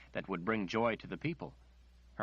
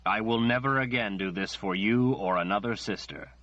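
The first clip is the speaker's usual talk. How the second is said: louder, forceful, unbending; firm refusal; 7 dB louder than usual. Acted. Nathan refuses Margaret angrily and loudly. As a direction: slam the door on the request.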